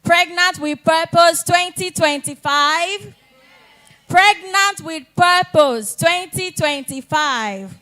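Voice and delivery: loud, slow, high chant. Shown as a demonstration. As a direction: call it out with full energy to the crowd. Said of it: "that's not the energy we need".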